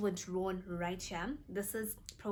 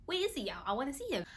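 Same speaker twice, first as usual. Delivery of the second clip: mock panic; 6 semitones higher, much faster than usual. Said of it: "she started panicking".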